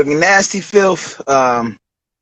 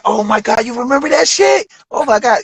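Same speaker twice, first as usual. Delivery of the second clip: excited reminiscing; 7 semitones higher than usual. Retold or imitated. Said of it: "be like".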